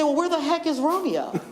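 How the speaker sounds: ordinary talk, nothing marked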